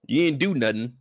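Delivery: ordinary talk, nothing marked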